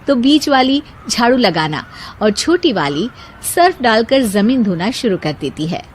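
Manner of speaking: usual speaking voice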